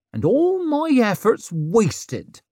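slow, high theatrical sigh; mock lament. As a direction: lament it dramatically. Isern mock lament after sigh.

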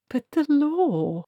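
high, slow, pleading cry; desperately. Acted. Treatle pleading with the archchancellor about the law, desperate. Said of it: "repeated Treatle desperately".

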